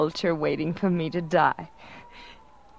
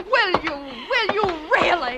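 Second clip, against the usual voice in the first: mocking; 11 semitones higher than usual. Victim mocking back.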